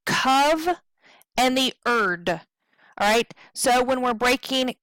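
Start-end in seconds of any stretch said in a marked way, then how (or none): 0.0-2.3 COV and ERD sounded apart, a pause between; split syllables. Shown as a demonstration. Teacher breaking covered into syllables, COV and ERD apart. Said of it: "We hear the cov".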